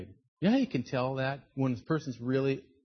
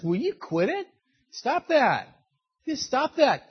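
higher-pitched scolding, sharp falling endings; acted fed-up telling-off; exasperated Moses; 8 semitones higher, 4 dB louder than usual. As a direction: cut off the whining with fed-up orders.

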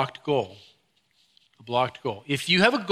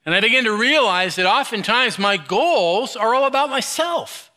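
higher, louder selfish griping; mock angry rant; 6 semitones higher, 4 dB louder than usual. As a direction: rant like a man blocked from his power. Selfish goals confessed heatedly.

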